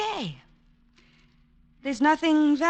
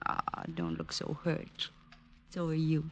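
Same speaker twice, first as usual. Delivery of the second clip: soft teasing; 10 semitones lower, 10 dB quieter than usual. Acted. Helen teasing Rick softly.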